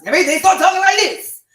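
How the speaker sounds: high, loud, fast pumped-up rap-star bark. Retold or imitated. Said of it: "He sounds just like D M X".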